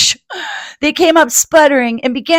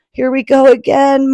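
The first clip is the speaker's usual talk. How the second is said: plain reading voice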